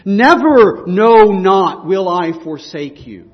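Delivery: stack the three negatives like hammer blows; triple negative stressed. Shown as a demonstration. Preacher driving home God's triple promise, each negative punched. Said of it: "triple negative".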